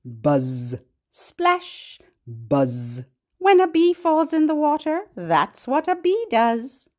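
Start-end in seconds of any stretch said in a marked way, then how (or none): 0.0-3.0 low buzz, then splash; buzzing bee. Shown as a demonstration. Teacher leads the kids in buzzing. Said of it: "Can you say that with me?".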